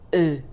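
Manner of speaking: E said as a short, rounded French "uh"; French E sound. Shown as a demonstration. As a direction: say E as the French schwa, lips rounded. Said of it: "is pronounced".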